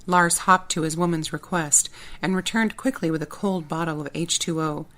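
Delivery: ordinary delivery, nothing marked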